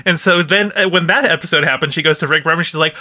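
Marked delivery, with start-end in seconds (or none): none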